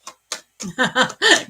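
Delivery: loud amused burst of laughter; real laugh. Real, not acted. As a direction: laugh out at the joke. Laughs at viewer's job offer.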